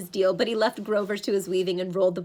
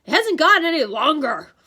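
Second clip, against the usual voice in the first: loud, raised Cyclops complaint; complaining monster; 7 semitones higher, 9 dB louder than usual. A narrator's reading. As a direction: grumble loudly as an impatient monster. Cyclops inspects Grover's weaving.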